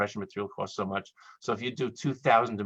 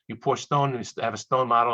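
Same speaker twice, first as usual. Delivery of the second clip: ordinary talk, nothing marked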